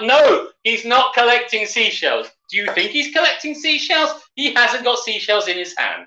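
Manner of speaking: high, slow, emphatic protest; incredulous high-pitched objection; mock exasperation. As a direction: argue back in hammed-up disbelief. Mock argument with the film.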